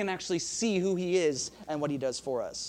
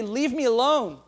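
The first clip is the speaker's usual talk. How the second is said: louder, higher pained plea to be left alone; anguished cry; 5 semitones higher, 7 dB louder than usual. Pretend. Preacher voicing Job crying out to God, anguished. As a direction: push it away, loud and hurt.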